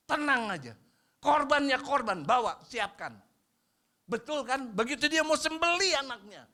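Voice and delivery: high, loud preaching; fiery preaching. Acted. Pastor urges the congregation to own mistakes, fiery and high. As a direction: drive the point home.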